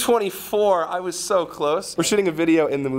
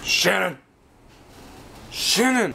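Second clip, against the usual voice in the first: calling out; 2 semitones higher than usual. Real, not acted. Calling out to Shannon.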